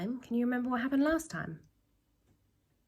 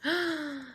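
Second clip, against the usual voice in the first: a storyteller's amazed gasp; 7 semitones higher than usual. Acted. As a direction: gasp at the surprise. Storyteller gasps at eagle.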